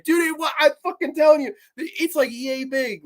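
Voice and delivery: high, excited insistence; high excited telling of the bug; freaked out. Real, not acted. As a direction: still rattled, telling it big.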